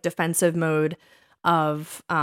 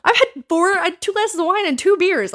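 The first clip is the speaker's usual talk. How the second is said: higher, louder mock exasperation; 10 semitones higher, 4 dB louder than usual. Retelling getting home drunk.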